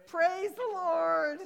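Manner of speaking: very loud, high, slow cry of praise; joyful praise. Retold or imitated. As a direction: belt it out in triumph. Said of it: "of course, said".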